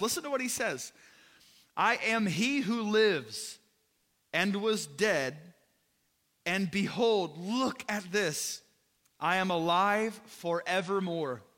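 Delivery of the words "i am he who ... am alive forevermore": reading Jesus' words slowly and with emphasis; slow scripture reading. Acted. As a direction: proclaim it slowly, each phrase weighted.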